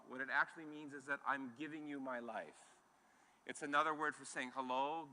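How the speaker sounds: usual speaking voice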